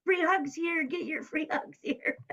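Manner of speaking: mock yell, calling out an offer; imitated yelling. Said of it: "he was yelling".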